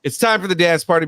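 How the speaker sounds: ordinary delivery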